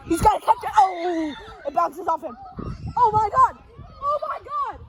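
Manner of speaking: high-pitched, hyped-up game commentary; play-by-play. Acted. Announcer hyping a strikeout and bobbled catch, excited play-by-play. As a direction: exclaim in escalating excitement as announcer.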